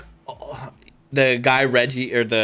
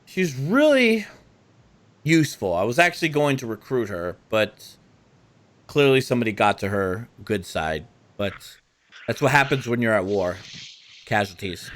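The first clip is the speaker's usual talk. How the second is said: scheming vampire; 2 semitones lower than usual. Acted. Game master voicing the court schemer, coolly dismissive. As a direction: shrug off the girl's fate.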